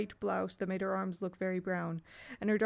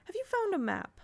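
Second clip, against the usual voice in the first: much higher, shot out fast; sudden sharp question; 11 semitones higher than usual. A narrator's reading. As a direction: flick the question out like lightning. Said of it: "words shot out like lightning".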